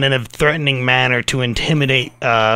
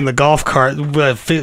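ordinary talk

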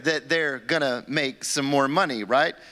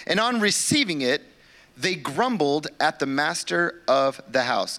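normal talking voice

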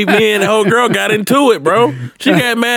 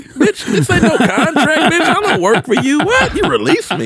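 laughing outrage; 9 semitones higher than usual. Real, not acted. Mocking girlfriend's text, laughing.

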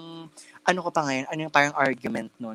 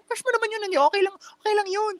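very high-pitched mocking quote; imitated dismissive people. People tell the victim it is okay, imitated in high mocking voice. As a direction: parrot their excuse, pitched far above your own. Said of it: "a lot of people asking".

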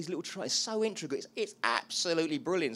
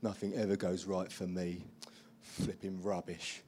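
lower, softer self-pitying grumble; wallowing self-pity; 6 semitones lower, 5 dB quieter than usual. Pretend. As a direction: sink into mock gloom. Voicing the wallowing inner voice.

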